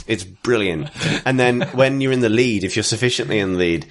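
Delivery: usual speaking voice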